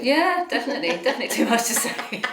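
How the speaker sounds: laughing through the words. Real, not acted. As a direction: giggle as you agree. Laughing agreement.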